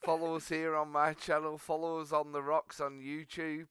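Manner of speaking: upbeat sign-off plug for the channel. Acted. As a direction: sell the subscribe pitch to viewers. Channel plug read to viewers.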